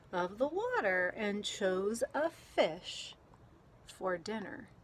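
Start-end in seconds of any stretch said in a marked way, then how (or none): none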